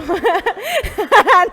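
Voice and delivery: loud, high burst of laughter; real, hearty laughing fit; amused laugh. Real, not acted. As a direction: laugh out loud at your own joke. Laughs at own joke.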